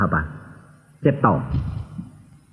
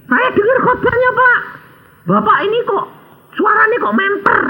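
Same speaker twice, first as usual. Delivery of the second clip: high-voiced seller. Seller's voice in retold story.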